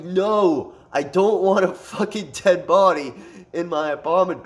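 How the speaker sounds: exasperated mock protest; retold urgent refusal. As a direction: shut the idea down emphatically. Retold alarmed refusal to buddy.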